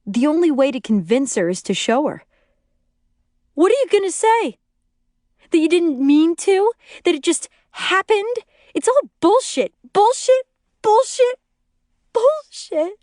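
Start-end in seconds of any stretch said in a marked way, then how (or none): 3.5-13.0 very high, hysterical crying rant. A narrator's reading. Betrayed wife rejecting every excuse, hysterical crying. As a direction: escalate the accusations into a high, tearful wail. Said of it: "she cries".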